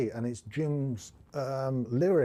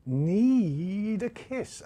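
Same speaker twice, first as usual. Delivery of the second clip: NEED held very long, higher and louder; lengthened NEED; 6 semitones higher, 6 dB louder, much slower than usual. Shown as a demonstration. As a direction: stretch out "Need" far beyond normal. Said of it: "make need last really, really longer".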